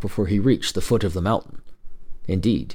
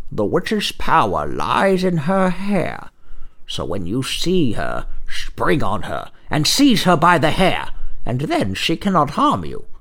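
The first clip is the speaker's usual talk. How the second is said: put-on little old man; 6 semitones higher than usual. Old man advises the brother.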